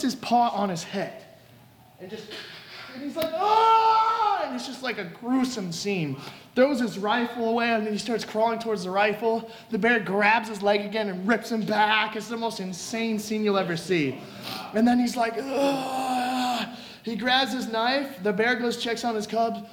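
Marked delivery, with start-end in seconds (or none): 3.3-4.4 long, high wail of pain; acted drawn-out howl; agonized scream. Acting man crushed by bear. 15.4-16.7 long, strained groan; acted drawn-out moan of pain. Acting man in agony.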